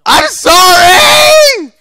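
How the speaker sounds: very high, drawn-out wail; screamed apology. Real, not acted. Gamer apologising mid-fight, screaming wail. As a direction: wail it at the top of your voice.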